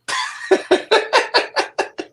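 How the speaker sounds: airy laugh, pitch jumps way up; high breathy laugh. Real, not acted. Laughs at own sarcastic joke.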